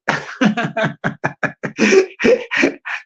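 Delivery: breathy, wheezy giggling fit; laughing. Real, not acted. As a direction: crack up at your own impression. Laughs at own impression.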